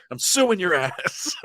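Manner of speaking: high, slow threat through laughter; laughing mock threat. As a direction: threaten a ghost, cracking up. Joking threat to a ghost.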